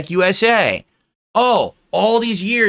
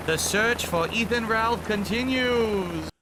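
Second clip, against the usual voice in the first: slow, higher, drawn-out announcer line; mock announcer; 4 semitones higher, 6 dB quieter, slower than usual. Closing a failed search, mock announcer. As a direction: stretch it out like a show narrator.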